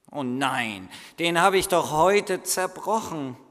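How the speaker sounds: loud, rising alarm; boy caught out, loud; dismayed boy. Acted. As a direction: cry out guiltily. Boy's dismay over broken cup.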